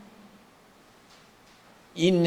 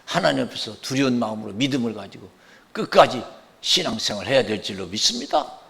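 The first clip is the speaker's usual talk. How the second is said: ordinary delivery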